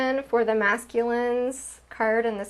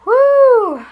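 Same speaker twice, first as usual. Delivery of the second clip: high, loud whoop of rushing energy; excited whoo; 13 semitones higher, 14 dB louder than usual. Real, not acted. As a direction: let out a big startled whoo. Card reading overwhelms her.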